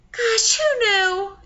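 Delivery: high, slow, tearful; crying. Acted. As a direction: let the tears break through. Friends tearing up together.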